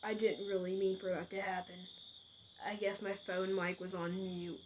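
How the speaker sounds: like a voice coming through a bad phone line; hushed stranger on the line; quiet caller voice. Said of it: "I finally heard a voice say".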